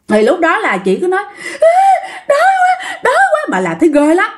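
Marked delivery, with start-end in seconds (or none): 1.6-3.4 very high, crying-laughing shriek; shrieking wail. Retold or imitated. Possessed woman shrieking at her colleague, crying and laughing. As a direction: shriek the hunger out, half laughing. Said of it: "cứ la lên".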